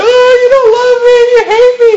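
very high, loud whiny wail; hammed-up tearful pleading in a squeaky high voice; mock crying wife. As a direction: imitate a begging wife sobbing in falsetto. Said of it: "begging him and crying and says".

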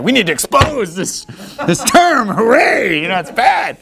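hammed-up outrage with stretched words; mock alarmed crusader. Preacher mocking internet listeners who condemn hooray, hammed-up indignation. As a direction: parody an indignant zealot sounding the alarm. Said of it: "People are gonna hear this sermon and be like".

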